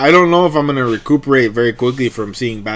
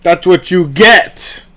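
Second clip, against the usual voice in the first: loud, slow scolding; angry; 4 semitones higher, 6 dB louder, slower than usual. Real, not acted. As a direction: tell them off, loud and slow. Scolding ants crawling on him.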